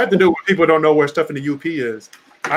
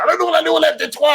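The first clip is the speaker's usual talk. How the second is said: mad fan; 11 semitones higher, 3 dB louder, faster than usual. Retold or imitated. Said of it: "this one guy who was so mad".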